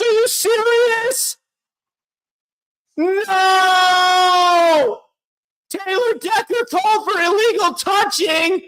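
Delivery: high, slow cries of disbelief; disbelieving outrage. Real, not acted. Fan outraged at the flag on the conversion, disbelieving cries. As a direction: plead with the refs in shock.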